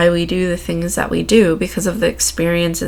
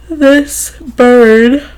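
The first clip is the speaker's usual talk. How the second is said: words stretched by a yawn; 6 semitones higher, 8 dB louder than usual. Real, not acted. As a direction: say it through a yawn. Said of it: "part of my yawn".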